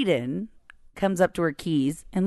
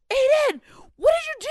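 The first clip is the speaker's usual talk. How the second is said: put-on high, raised-voice alarm at a child; retold freak-out; 4 dB louder, faster than usual. Mom reenacting yelling at son over the spray, freaking out. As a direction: scold in a panic, pitch shooting up. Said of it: "And I'm freaking out".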